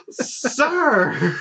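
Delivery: high gasped exclamation breaking into laughter; gasp, then squeal it with a laugh; mock shock. Teller mimics the scandalised clerk, mock shock.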